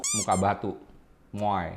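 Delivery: normal talking voice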